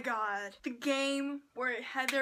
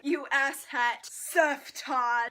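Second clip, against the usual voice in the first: mock insult; 8 dB louder, much slower than usual. Quoting Noah's insults.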